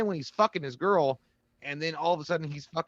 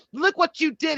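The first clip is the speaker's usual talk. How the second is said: high indignant woman; 13 semitones higher, 5 dB louder than usual. Retold or imitated. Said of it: "She's like".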